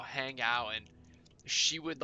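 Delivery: ordinary talk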